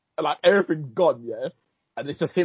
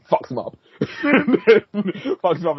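breaking up laughing mid-retelling; real laugh bubbling through the words; 9 semitones higher, 4 dB louder, much slower than usual. Real, not acted. Podcaster retelling the fight to a friend, laughing.

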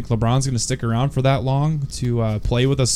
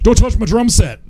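quoting Step Brothers; 9 semitones higher than usual. Retold or imitated. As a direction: yell it like the movie. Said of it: "everybody just kept".

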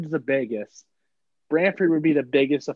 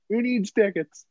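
pushy ticket tout; 7 semitones higher, slower than usual. Pretend.

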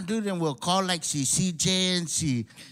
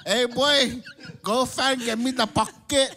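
imitating an island uncle's Guam accent, higher; Uncle Kiko; 6 semitones higher, slower than usual. Uncle sending nephew on an errand, Guam-accented call. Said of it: "I got my Uncle Kiko Bungi".

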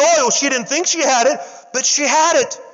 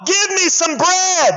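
prophet's demand; 7 semitones higher, slower than usual. Acted. Preacher shouts prophet's demand.

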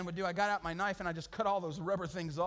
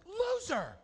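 high mocking taunt; 13 semitones higher than usual. Calling friend a loser.

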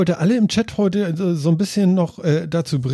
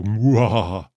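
villain laugh; 6 semitones lower, slower than usual. Pretend. Mock evil laugh in chat.

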